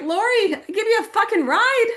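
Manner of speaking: very high, incredulous protest; exasperated yell. Retold or imitated. Friend plays down saving her life, exasperated high yell. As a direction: imitate a friend yelling back in disbelief.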